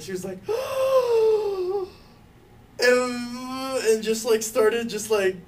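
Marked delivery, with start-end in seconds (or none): 0.4-2.7 long high imitated gasp into a hum. Son mimicking mom's strange gasping noise, loud and high. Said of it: "the weirdest noise I've ever heard".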